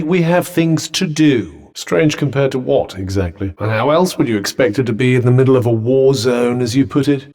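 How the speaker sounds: normal talking voice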